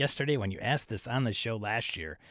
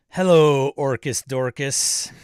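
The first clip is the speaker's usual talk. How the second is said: loud, drawn-out mock-disdainful sitcom greeting; Jerry; 3 semitones higher, 9 dB louder, slower than usual. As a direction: punch the hello, then stretch out the name. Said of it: "like Jerry would say, "Hello, Newman".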